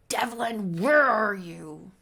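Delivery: loud, drawn-out mock yell; freaking out. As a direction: call out for him, slowing on the question. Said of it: "She is absolutely freaking out".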